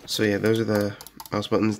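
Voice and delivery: ordinary talk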